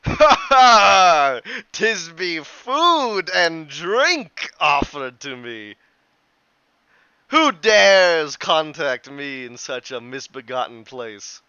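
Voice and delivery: loud, slow, jovial booming voice; hearty booming laugh and greeting; booming happy spirit. Acted. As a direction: boom it out like a merry unseen host. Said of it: "a booming, happy voice".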